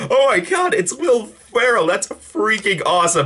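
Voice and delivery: high-pitched fan thrill; giddy retold excitement; excited. Recalled thrill at surprise cameo.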